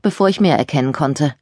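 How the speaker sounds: ordinary delivery, nothing marked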